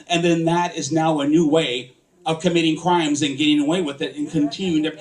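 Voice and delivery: ordinary delivery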